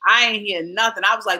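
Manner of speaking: normal talking voice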